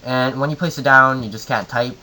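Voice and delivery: ordinary delivery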